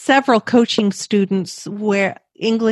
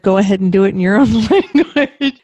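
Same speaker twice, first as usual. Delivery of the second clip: laughing quote; 5 dB louder, faster than usual. Retold or imitated. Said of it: "you said".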